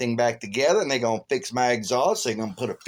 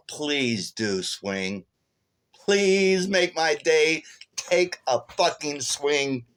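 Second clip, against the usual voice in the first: slow, menacing retold challenge; drawn-out goading, word by word; taunting dare; 4 semitones higher, much slower than usual. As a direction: dare him to hit you, drawing out every word. Dares the man to hit him.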